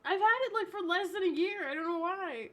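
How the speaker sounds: high, loud exasperated laugh. Real, not acted. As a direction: throw it up in disbelief. Exasperated about dying computer.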